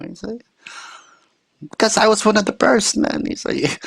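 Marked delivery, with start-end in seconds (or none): 1.6-3.2 high, louder imitation of a griping uncle; indignant uncle. Uncle voiced in story.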